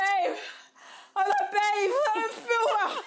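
high, loud, drawn-out plea; hammed-up poorly whine with a laugh in it; mock feeble whine. Sick girlfriend telling boyfriend she feels unwell, mock whining plea.

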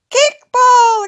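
high, loud eager cry; exclaimed. A narrator's reading. Conrad proposing a game to friends, loud excited exclamation. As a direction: call it out to rally the gang. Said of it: "exclaimed Conrad".

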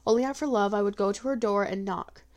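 usual speaking voice

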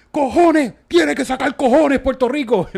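high, loud mock rant of the radio host; imitating angry radio host. Radio host ranting at Puerto Rico, mock furious shout. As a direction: ham up the outraged host yelling at the public. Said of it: "Lo dijo así, dijo".

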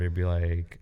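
ordinary talk, nothing marked

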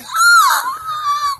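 high loud screech in pain. Retold or imitated. Telling friends how he screeched, loud high screech. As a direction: screech like you're hurt. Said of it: "like, screeching, like, I'm in pain".

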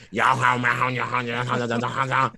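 garbled mock Cajun gibberish; Cajun accent. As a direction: slur it into unintelligible Cajun mumbo-jumbo. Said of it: "That's that Cajun accent".

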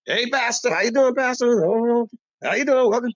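high, sugary welcome to the pastor; butter up the pastor; fawning greeting. Retold or imitated. Barbershop crowd fawning over the pastor in a high sweet voice.

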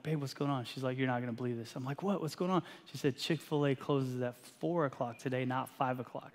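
quiet, hushed voices; hushed car exchange. Retold or imitated. Husband and wife in the car hearing bad news, hushed.

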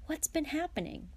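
high, mildly impatient question. A narrator's reading. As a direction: push eagerly for an answer. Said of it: "said Ron impatiently".

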